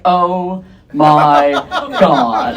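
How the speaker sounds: low, unhurried, unexcited drop; deep-voiced, underwhelmed reaction, pausing before the last word; deadpan freak-out. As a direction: freak out with no hysteria at all. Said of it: "I freak out".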